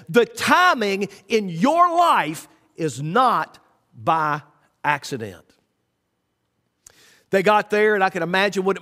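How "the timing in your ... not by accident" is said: slow emphasis. Real, not acted. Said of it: "repeats line slowly for emphasis".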